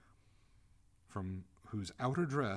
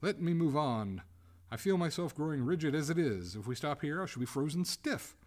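high, loud, urgent begging; acted frantic appeal; panicked plea; 8 semitones higher, 9 dB louder than usual. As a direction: plead in alarm to keep moving. Ghost begs not to freeze.

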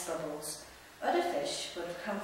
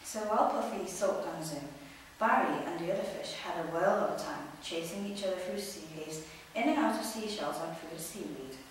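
plain reading voice

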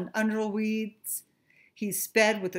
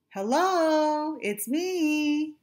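high, louder call to friends; 8 semitones higher, 5 dB louder, much slower than usual. Acted. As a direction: call out brightly to find your friends. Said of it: "he called to his friends".

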